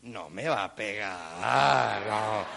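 very low, slow drawn-out thought; trusting small child. Retold or imitated. Three-year-old reassures himself about mom, slow low certainty. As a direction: draw it out deep and dopey. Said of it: "uno dice".

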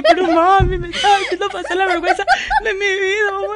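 very high, loud, laughing complaint; playful daughter protesting to mom; mock whiny daughter. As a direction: whine like a mortified teen. Voices embarrassed daughter.

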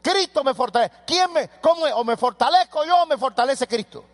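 high, loud fiery preaching; preaching at full force. Acted. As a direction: thunder it from the pulpit. Preacher rising again.

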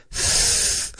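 whispered lisping hiss; imitating lisped hiss. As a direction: hiss the S with a lisp. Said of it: "sometimes she says".

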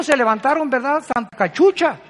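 ordinary delivery

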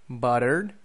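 "Buttered" said as American "butter", T tapped, final R sounded, rising; American demo of "Buttered" as butter, tune left open; American accent. Shown as a demonstration. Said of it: "T's in the middle of words sound like R".

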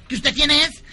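insistent cartoon alien; pestering Martian. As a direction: challenge a silent stranger, comically. Martian pestering the fuel pump.

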